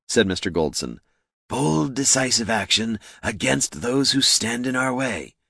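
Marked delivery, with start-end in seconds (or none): 1.5-5.4 slow, higher-pitched grand declaration; pontificating boss. A narrator's reading. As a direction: draw out each word with lordly conviction. Boss declares his ruthless creed.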